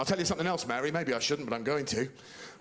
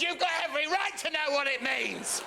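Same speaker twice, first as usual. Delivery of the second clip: mock shout; 11 semitones higher than usual. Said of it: "I said".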